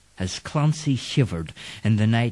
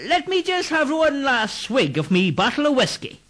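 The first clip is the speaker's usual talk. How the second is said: higher coaxing voice; wheedling Irishman; 13 semitones higher than usual. Acted. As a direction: sweet-talk for a swig. Clancy asks for whiskey.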